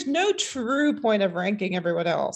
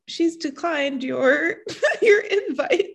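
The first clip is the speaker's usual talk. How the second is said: slow, laughing read of the message; 7 semitones higher, slower than usual. Retold or imitated. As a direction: giggle through a slow reading.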